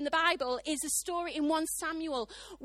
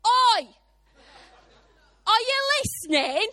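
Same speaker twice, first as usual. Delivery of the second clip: loud, high, abrupt shout; comically blunt holler, a sudden contrast to gentleness; Holy Spirit hollering; 7 semitones higher, 11 dB louder than usual. Acted. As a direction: yell to grab someone's attention. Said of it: "he went".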